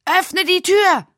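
high, slow, loud demand; yelling. Acted. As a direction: yell it while rattling the door. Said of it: "schrie er".

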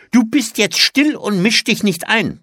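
strict, loud, high-pitched telling-off; streng. A narrator's reading. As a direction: silence a child sternly. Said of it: "sagte die Tante streng". (streng und laut)

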